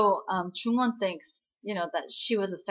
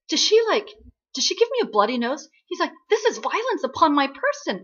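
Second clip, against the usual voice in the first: high, loud, affronted protest; mock indignation; 6 semitones higher, 6 dB louder than usual. Security guard protesting his bloody nose, mock outrage. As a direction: imitate an outraged victim, pitched up.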